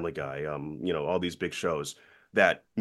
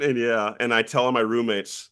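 usual speaking voice

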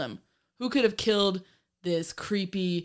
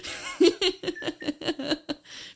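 burst of high laughter; 10 semitones higher than usual. Real, not acted. Reacting to the boss quote.

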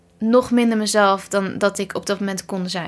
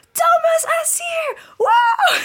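shrieked name, much higher and louder; fan scream; 3 dB louder, much slower than usual. Retold or imitated. Fan shrieking a singer's name at the awards. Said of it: "super hard geschreeuwd".

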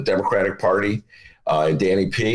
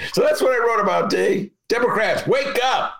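crying voice; 13 semitones higher than usual. Pretend. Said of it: "he's got the crying voice going on".

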